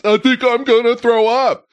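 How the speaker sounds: high, put-on queasy wail; mock someone about to be sick; queasy friend impression. Voicing friend about to vomit.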